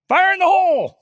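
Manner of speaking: high-pitched, full-volume holler; warning shout. Pretend. Pastor demonstrating a warning he hopes none heard in person, shouted. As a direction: yell a danger warning. Calling out familiar warning cries.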